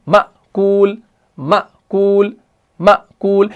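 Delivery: repeated "मअकूल", each with an abrupt catch after "म"; hamza jerk drill. Shown as a demonstration. Teacher drilling the word for students, hamza jerk. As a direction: drill "मअकूल" with a stop at the hamza and long "कूल". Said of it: "हाँ, तो हम पढ़ते हैं".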